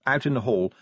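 ordinary delivery, nothing marked

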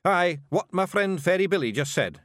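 high, loud Scots bluff; Scottish Feegle, struggling. A narrator's reading. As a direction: agree lamely, keeping up the act. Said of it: "he managed".

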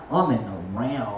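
low, hushed awe; awed low voice. Retold or imitated. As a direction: drop deep into wonder. Quoting herself, awed.

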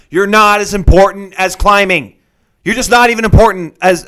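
loud, hammered-out acted put-down; emphatic telling-off. Climber telling girlfriend she ranks below climbing, emphatic.

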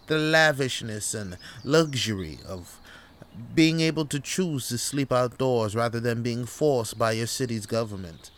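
put-on grandly affected English accent; mock English camper extolling luxury. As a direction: hold forth as a pompous Englishman. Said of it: "you're talking with an English accent".

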